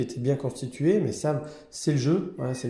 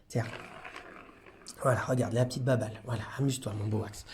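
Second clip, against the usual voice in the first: soft soothing; 5 semitones lower, 8 dB quieter, faster than usual. Real, not acted. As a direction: lower and much softer, coaxing. Soothing a cat with a toy.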